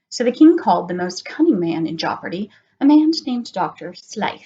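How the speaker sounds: put-on gentle read-aloud to children; storybook narration. A narrator's reading. Teacher reading a picture book to children. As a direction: tell it like a bedtime story.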